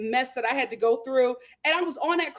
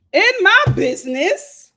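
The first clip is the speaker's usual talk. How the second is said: louder, higher; 7 semitones higher, 9 dB louder than usual. Real, not acted. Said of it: "Oh, in my business".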